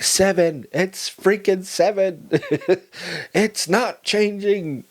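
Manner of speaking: exasperated patient repeating himself. Retold or imitated. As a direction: drag it out, sick of being asked. Repeated pain question, exasperated answer.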